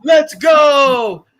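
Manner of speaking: loud mocking "Let's go" shout. Mocking the movie's soldiers with a loud shout. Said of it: "they said phrases in this movie like".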